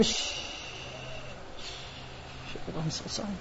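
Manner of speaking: very soft shushing and sorry; hushed apology. Acted. Wife to husband she woke, hushed apology. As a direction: shush him, then apologise under your breath.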